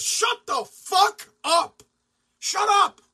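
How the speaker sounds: loud, high, furious yell; angry shout. Real, not acted. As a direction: bark it at the players, done listening. Fed up with whining players.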